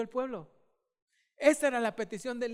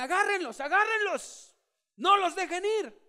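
higher, louder commanding call; Elijah's order; 8 semitones higher, 5 dB louder than usual. Retold or imitated. Elijah commands the crowd to seize the prophets, loudly. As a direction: order the people to seize them. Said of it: "Elías ordena".

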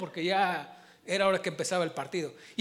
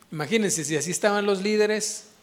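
normal talking voice

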